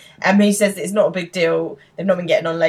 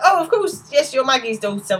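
sudden high recognition, then settling; bright jolt of remembering; penny drops; 7 semitones higher, 3 dB louder than usual. Retold or imitated. Will suddenly recognising his old babysitter, surprised. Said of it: "the penny drops".

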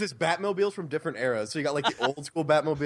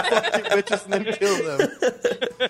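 mock hitman; 4 semitones higher, 4 dB louder, much slower than usual. Hitman joke voice.

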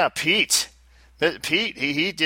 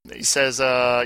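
usual speaking voice

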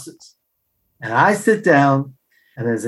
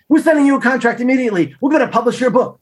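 higher, quick eager announcement; acted-out eager publisher; excited publisher; 9 semitones higher, faster than usual. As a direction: deliver the big news fast and high. Publisher's good news acted out.

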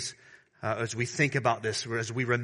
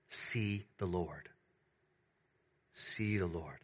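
softer, lower, slow hush; hushed emphasis; 5 semitones lower, 9 dB quieter, slower than usual. Acted. Preacher quietly urging the church to see the Lord. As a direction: lean in and hush it. Hushed repeated closing point.

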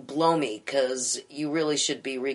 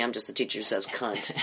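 deadpan; 4 semitones lower, 7 dB quieter than usual. Real, not acted. Deadpan self-description after the quote.

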